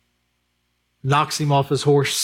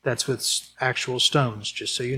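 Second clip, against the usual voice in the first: soft aside; 3 semitones lower, 7 dB quieter than usual. Real, not acted. Quiet aside during reading.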